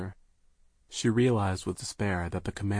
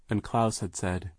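plain reading voice

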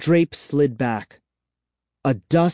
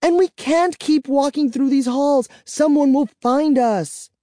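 high, loud, desperate pleading; frantic and scared; panicked; 4 dB louder than usual. Acted. Kara panicked, frustrated.

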